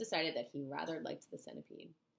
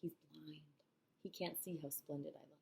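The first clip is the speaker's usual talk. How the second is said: whispering; 7 dB quieter than usual. A narrator's reading. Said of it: "whispering in Jim's ear".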